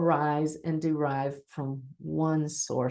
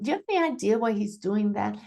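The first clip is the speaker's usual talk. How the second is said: higher, quick questioning delivery; unsure questioning; 4 semitones higher, faster than usual. Shown as a demonstration. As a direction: ask it as if you don't know. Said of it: "be aware of how you're delivering".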